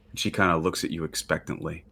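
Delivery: usual speaking voice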